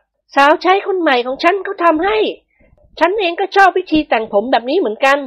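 high, gentle ladylike voice; high-voiced mistress of the house, pleased; lady of the house. A narrator's reading. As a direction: voice the madam sweetly pleased with her hair.

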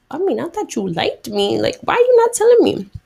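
high-pitched disbelieving complaint; stunned woman. Retold or imitated. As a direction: imitate a wife baffled and put out. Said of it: "the woman was stunned to speak".

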